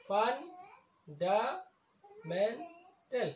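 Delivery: "Fundamental" in four slow beats; syllable by syllable. Shown as a demonstration. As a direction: break "fundamental" up with gaps. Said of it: "ইয়াত চাৰিটা চিলেবুল আছে". ("Fundamental" চাৰিটা ভাগত ভাঙি লাহে লাহে)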